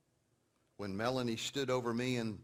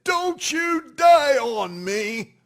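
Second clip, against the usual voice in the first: slow furious shout, much higher and louder; angry yell; 16 dB louder, much slower than usual. Retold or imitated. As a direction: yell it at him in anger, dragging each word. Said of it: "she yelled at me in anger".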